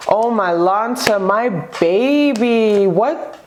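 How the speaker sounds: very slow, stretched-out disbelief; slow-motion astonishment; stunned, drawn-out. Real, not acted. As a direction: drag every word out in amazement. Stunned reaction to the cards.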